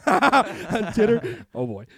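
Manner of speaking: words squeezed out slowly through laughter; laughing. Real, not acted. Laughing at his own slip while promoting a guest, laughing slow and loud. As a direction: laugh while correcting yourself. Laughing after saying Titter.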